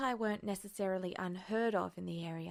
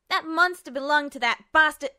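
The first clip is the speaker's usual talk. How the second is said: much higher, much louder enraged outburst; furious shout; 8 semitones higher, 13 dB louder than usual. A narrator's reading. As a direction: hurl the insult in fury. Furious girl insults the general.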